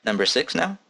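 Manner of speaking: ordinary delivery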